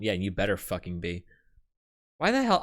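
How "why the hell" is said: exasperated outburst. Real, not acted. Exasperated reaction.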